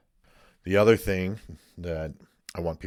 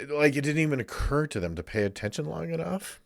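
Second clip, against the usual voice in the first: ordinary talk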